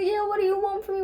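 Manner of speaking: frantic panic. Retold or imitated. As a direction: cry it up the dark steps, high and rushed. June running frantic up the steps.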